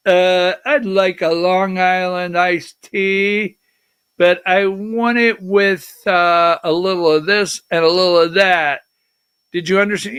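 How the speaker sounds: mock bar customer. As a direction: drag out the order as if testing the bartender. Acting bar customer testing robot bartender.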